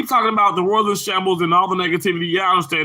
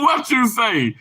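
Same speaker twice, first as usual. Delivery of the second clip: normal talking voice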